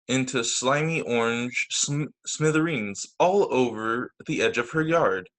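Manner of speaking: ordinary delivery, nothing marked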